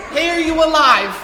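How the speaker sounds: calling out. Retold or imitated. King Darius calling down into the lions' den, loud call. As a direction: shout it down into the den. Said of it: "he's like".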